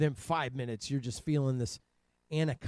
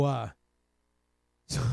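usual speaking voice

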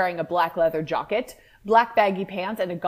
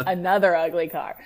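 usual speaking voice

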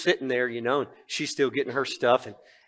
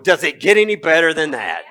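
laughing; 4 semitones higher, 11 dB louder than usual. Retold or imitated. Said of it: "And I'm going".

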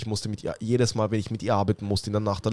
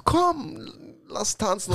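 1 imitating the boss; 8 semitones higher, 3 dB quieter, much slower than usual. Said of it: "sagt so".

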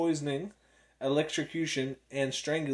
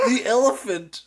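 laughing through the words; giggling, loud and high; 10 semitones higher, 10 dB louder than usual. Real, not acted. Laughing at the reveal.

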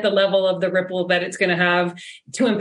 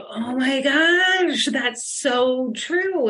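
slow, drawn-out recalled amazement; retold awed realization; 4 semitones higher, much slower than usual. Guest recalling her reaction to the quote, retold awe. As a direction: stretch the words out slowly, as if reliving the realization. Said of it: "I remember thinking about that and going like".

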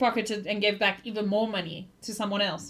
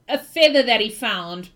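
ordinary delivery

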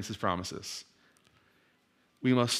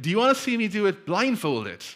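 higher showman call; showman challenge; 7 semitones higher than usual. Retold or imitated. Walker dares the crowd, higher showman call. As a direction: tease the crowd with a dare.

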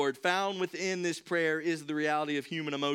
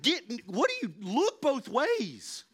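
put-on higher, sharp warning to a child, last words very slow; alarmed parent yell; 6 semitones higher, slower than usual. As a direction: snap at the kid running into the road. Parent yelling at child in road.

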